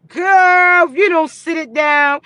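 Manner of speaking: loud call. Real, not acted. Scolding a girl watching, loud high call.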